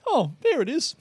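mock-calm meditation guide, pitched high; lilting, gentle little discovery; Andy. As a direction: imitate an irritatingly serene teacher. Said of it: "he's so calm all the time".

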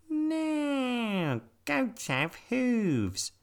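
high, slow bouncy rabbit. A narrator's reading. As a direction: speak like a little rabbit. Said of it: "said the rabbit".

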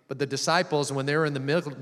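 normal talking voice

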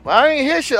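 normal talking voice

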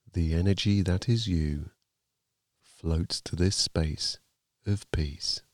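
ordinary talk, nothing marked

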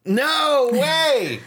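high, loud delighted disbelief; amazed shout. Real, not acted. Guessed the fake headline.

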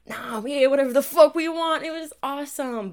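loud, high and gleeful; excited. Real, not acted. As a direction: burst out with glee. Excited memory of free childhood.

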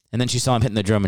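ordinary talk

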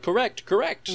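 high, sing-song quiz buzzer voice; quiz show hat. Pretend. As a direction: chirp it like a game show hat. Voicing the novelty hat.

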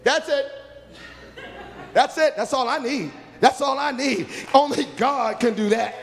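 high, loud, laughing cry of joy; joyful outburst. Retold or imitated. Preacher recalling his joy, high laughing cry. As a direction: burst out with delight. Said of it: "I said".

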